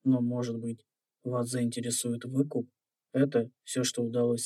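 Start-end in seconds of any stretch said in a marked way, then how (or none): none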